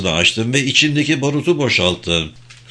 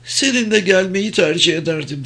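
higher-pitched, gently imploring woman; pleading; 8 semitones higher than usual. A narrator's reading. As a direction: plead in a raised voice. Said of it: "Sesinde yalvaran bir ifade vardı".

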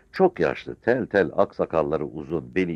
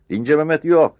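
high, booming defiant cry; sudden roar; 12 semitones higher, 9 dB louder than usual. A narrator's reading. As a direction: thunder it out unexpectedly. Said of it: "beklenmeyen bir sesle gürledi". (birden gürleyerek)

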